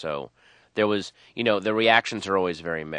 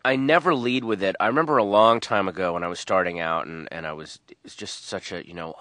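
normal talking voice

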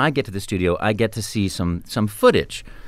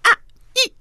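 high squeaky game grunts; quick cartoon exclamations; short game sounds; 4 dB louder, much faster than usual. Shown as a demonstration. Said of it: "so you go".

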